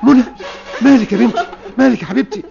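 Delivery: rising panic over his daughter; panicked father. Acted. Father panics seeing his torn-clothed daughter, alarmed shout.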